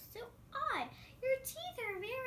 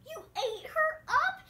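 louder, higher disbelieving cry; shocked; 8 semitones higher, 8 dB louder than usual. Acted. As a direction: gasp it out in horror. Shocked at wolf eating grandma.

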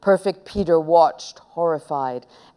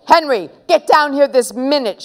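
raised, cross mother's call; shouting; 7 semitones higher, 4 dB louder than usual. A narrator's reading. Mum ordering her son downstairs, cross shout. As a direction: call up the stairs, louder and higher. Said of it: "shouted Mum".

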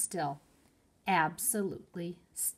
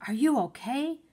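raised, higher call across the distance; shouting; 6 semitones higher, 5 dB louder than usual. A narrator's reading. Annie calls down to Jack from the treehouse, shouting. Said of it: "Annie shouted from the treehouse".

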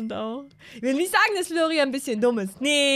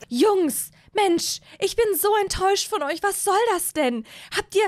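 higher-pitched disappointed mother scolding; higher mom voice telling the boys off; 10 semitones higher, 5 dB louder than usual. Acted. Voicing the scolding Sim mom.

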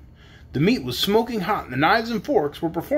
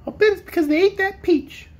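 very high little kitten voice; kitten crying out; 14 semitones higher than usual. A narrator's reading. Kitten guessing why piglets vanished, high cry. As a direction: squeal it as a small cat. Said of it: "cried the kitten".

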